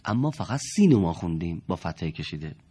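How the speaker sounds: normal talking voice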